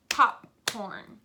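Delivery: "Popcorn" in two separate beats; split into syllables. Shown as a demonstration. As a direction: say "Popcorn" with a break between syllables. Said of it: "Popcorn has two syllables".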